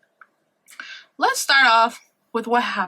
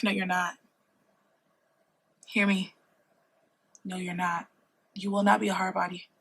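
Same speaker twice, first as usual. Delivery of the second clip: low firm warning; 4 semitones lower, 10 dB quieter than usual. Acted.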